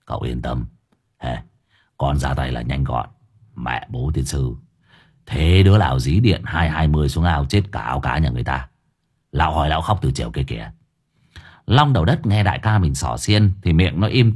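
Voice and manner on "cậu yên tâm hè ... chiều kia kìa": low, soft voice of a sneering boss; mock quietly in a low, pouting voice; low character voice, dismissive sarcasm; Hải Nghẹo, scornful. A narrator's reading. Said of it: "Đoạn bĩu môi".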